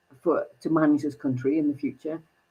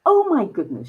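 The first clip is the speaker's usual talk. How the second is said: ordinary delivery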